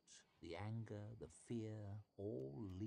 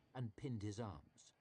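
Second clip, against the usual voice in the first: ordinary delivery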